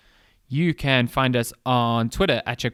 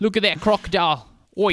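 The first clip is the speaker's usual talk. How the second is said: hammed-up Australian accent, higher pitch; a playful jokey Australian croc hunter voice; mock Australian accent; 7 semitones higher than usual. Cohost riffing on Hemsworth's Australian accent in mock Australian accent. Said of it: "he talks in an Australian accent".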